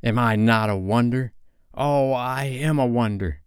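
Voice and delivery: dreamy self-admiration; conceited. A narrator's reading. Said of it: "he whispered to her".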